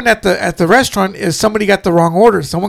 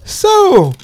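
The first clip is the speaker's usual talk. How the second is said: loaded "so"; 11 semitones higher than usual. Retold or imitated. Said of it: "And he goes".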